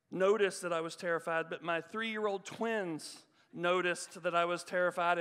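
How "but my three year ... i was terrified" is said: ordinary talk, nothing marked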